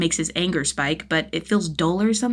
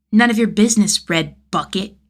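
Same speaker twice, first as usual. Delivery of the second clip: put-on higher, louder bratty child retort; cheeky kid voice, louder; sassy kid; 4 semitones higher, 7 dB louder than usual. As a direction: snap back like a cheeky boy. Child sassing Jason.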